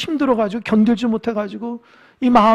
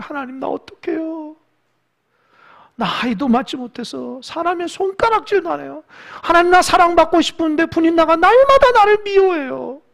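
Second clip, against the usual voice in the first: higher, wailing plea to God; pleading lament; 7 semitones higher than usual. Retold or imitated. Hannah wailing her prayer to God, higher pleading lament. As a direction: cry out your grief to God.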